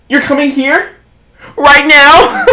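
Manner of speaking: high, loud imitated shock; mock panic. Girl hearing her mom is coming, mock alarmed. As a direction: gasp it out in disbelief.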